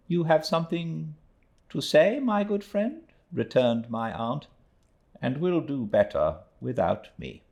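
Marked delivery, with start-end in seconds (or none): 0.1-3.0 put-on high, prim elderly lady; aunt's voice. A narrator's reading. Aunt to Mister Peggotty, high prim voice. 5.2-7.4 put-on high, prim elderly lady; aunt's voice. A narrator's reading. Aunt to Mister Peggotty, high prim voice.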